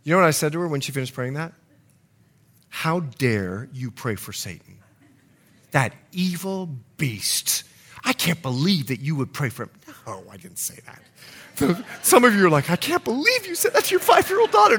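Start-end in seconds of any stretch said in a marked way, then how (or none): 2.7-9.7 low, hushed reproach, put on; mock stern scolding. Father pretending to scold his praying daughter, mock sternness. 12.7-14.8 high, fast, squealed disbelief; mock shock. Preacher mimicking his congregation's reaction, exaggerated shock.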